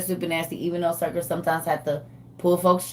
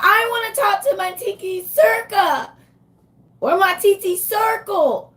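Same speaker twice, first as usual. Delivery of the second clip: put-on whiny little kid; 13 semitones higher, 4 dB louder than usual. Host mimicking a grandbaby demanding to talk to her. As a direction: imitate a small child begging for her auntie. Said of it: "be yelling at they mama's phones".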